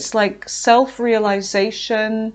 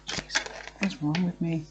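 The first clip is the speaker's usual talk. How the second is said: softer self-scolding murmur; quiet aside; 4 semitones lower, 11 dB quieter than usual. Real, not acted. Reader muttering at herself after a slip, quiet aside. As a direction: mutter at yourself, lower.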